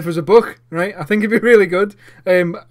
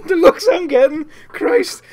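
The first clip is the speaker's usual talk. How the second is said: laughing; 12 semitones higher, 5 dB louder, slower than usual. Real, not acted.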